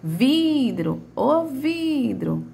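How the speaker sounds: "vidro" called out with a long "vi"; "vidro" with held, stressed "vi"; stretched first syllable. Shown as a demonstration. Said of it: "a sílaba de maior tonicidade na palavra vidro".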